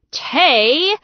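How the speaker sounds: a loud, high bellow of her name. Acted. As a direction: shout her name in alarm. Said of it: "Gretchen bellowed".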